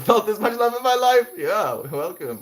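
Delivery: high, loud fast giggly gushing; overwhelmed happy laughter; laughing. Real, not acted. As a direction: laugh through the words.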